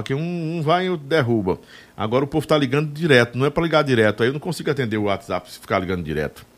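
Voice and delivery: ordinary talk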